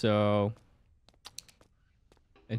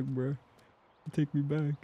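normal talking voice

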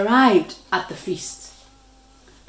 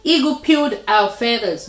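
usual speaking voice

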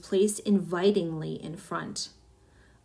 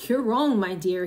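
plain reading voice

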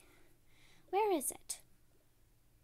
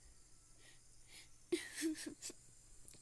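soft crying; 4 semitones higher, 5 dB quieter than usual. Acted. Girl cries alone after accident.

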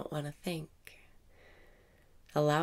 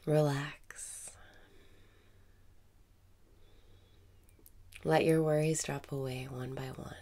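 guided meditation. Acted. Meditation teacher guiding listeners through breathing, calm meditation voice.

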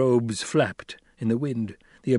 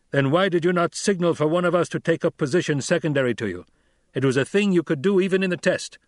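raised, firm voice of a stern desert chief; Stilgar, stern Fremen leader; 6 semitones higher, faster than usual. A narrator's reading. Stilgar rebuking Paul after his sloppy ride, stern. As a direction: scold the young rider with authority.

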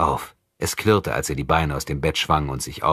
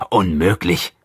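a startled, louder burst; 5 dB louder, slower than usual. A narrator's reading. Vlad hearing the prisoners' plan, startled and loud. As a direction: blurt it out, caught off guard. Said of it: "sagte Vlad erschrocken".

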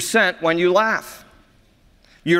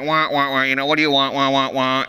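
lightly imitated garbled drive-thru speaker; a hint of muffled wah-wah mumbling; Charlie Brown's teacher; 4 semitones lower than usual. Pastor imitating the drive-thru speaker, mock garbled mumble. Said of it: "sound like Charlie Brown's teacher".

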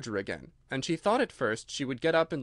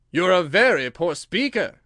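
King voice; 4 semitones higher, 9 dB louder than usual. A narrator's reading.

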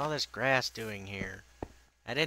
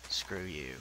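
low muttered insult; 6 semitones lower, 6 dB quieter than usual. Acted. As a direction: lower and softer, muttered. Insult to tree, lower.

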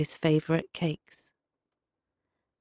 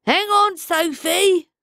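high urgent shout; calling out; 13 semitones higher, 5 dB louder, slower than usual. A narrator's reading. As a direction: call out across the rushing water. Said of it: "called Grimacy".

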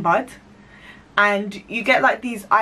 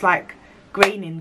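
normal talking voice

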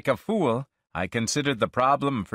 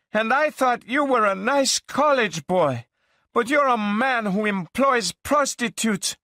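put-on high, loud, accusing girl's voice; angry accusation; 13 semitones higher, 4 dB louder than usual. Girlfriend accusing him of hiring prostitutes, high and loud. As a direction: hurl it at him, high and louder.

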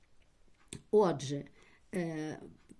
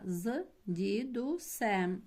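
"з дідусем" said very slowly; teacher dictating slowly, writing along; slow dictation; much slower than usual. Shown as a demonstration. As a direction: drag out "з дідусем" as you write. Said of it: "Я знайшла".

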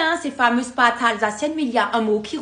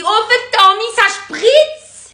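excited exclamation; 8 semitones higher, 5 dB louder than usual. Pretend. Mock exclamation at spilled coke.

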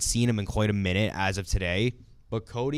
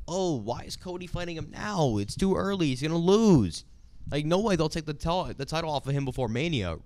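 higher-pitched mock fan voice; imitating worried fans; 7 semitones higher than usual. Host voices doubtful fans guessing Cody will lose, mild mock worry. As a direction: play a fretting fan, lightly. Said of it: "you could have fans thinking that, like".

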